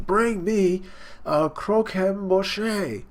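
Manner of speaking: high, very slow, dragging out the word; drawn-out demand. Retold or imitated. Big Mom craving her cake, a slow drawn-out demand. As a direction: stretch Croquembouche out long and pleading-hungry.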